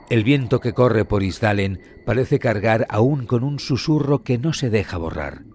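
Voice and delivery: usual speaking voice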